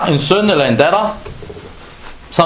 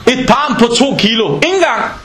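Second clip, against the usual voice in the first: excited exclamation; 5 semitones higher than usual. Real, not acted.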